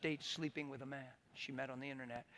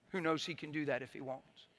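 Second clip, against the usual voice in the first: ordinary talk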